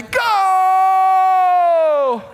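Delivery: loud, high yelled go; full shout. Retold or imitated. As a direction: holler it across the hall. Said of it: "he's yelling".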